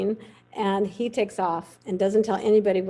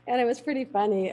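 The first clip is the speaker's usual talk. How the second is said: ordinary talk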